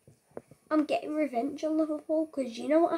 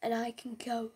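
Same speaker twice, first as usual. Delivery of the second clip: hushed, conspiratorial whisper of the plan; secretive whisper; 3 semitones lower, 5 dB quieter than usual. Acted. Gamer whispers his secret mission to viewers, conspiratorial whisper.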